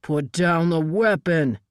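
slow, firm commanding order. Acted. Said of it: "Nathan commanded again".

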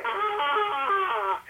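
very high mock cry of dismay; sudden high pained wail; high audience wail. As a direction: shriek it like a crowd in anguish. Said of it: "they all went".

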